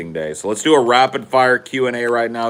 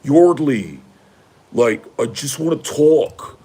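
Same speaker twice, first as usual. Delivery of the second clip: put-on higher, drawn-out entitled rich boy plea; smug rich boy voice, pitched up; imitating George Hughley; 4 semitones higher, slower than usual. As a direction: play the privileged college boy coaxing her calmly. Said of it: "I'm doing a very good impression of George Hughley".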